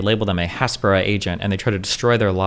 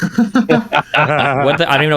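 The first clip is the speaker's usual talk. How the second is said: laughing; 6 semitones higher, 4 dB louder, much slower than usual. Real, not acted. Guest's odd label amuses him.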